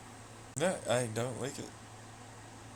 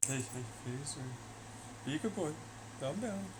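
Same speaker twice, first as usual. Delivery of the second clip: higher, soft coaxing voice to a dog; sweet-talking the dog to calm down; pet talk; 4 semitones higher, 9 dB quieter than usual. Real, not acted. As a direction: settle the pup down gently. Soothing his dog Phaser.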